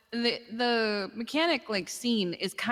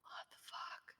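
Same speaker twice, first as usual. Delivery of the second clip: whispered; 23 dB quieter, slower than usual. Real, not acted. Shocked at a post online.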